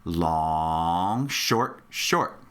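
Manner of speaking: LONG stretched low, each SHORT brief; rhythm demo. Shown as a demonstration. Teacher modelling the rhythm for students, long held out, shorts brief. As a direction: hold "long", then two quick "short"s. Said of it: "One more time".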